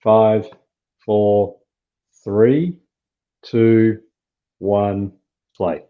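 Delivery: loud, evenly spaced count; measured countdown to pressing play. Real, not acted. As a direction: count everyone in, pausing between numbers. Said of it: "we'll give it a countdown".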